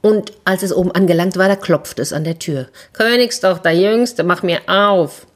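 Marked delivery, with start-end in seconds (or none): 2.9-5.3 lightly voiced frog calling at the door; a hint of a frog begging to be let in.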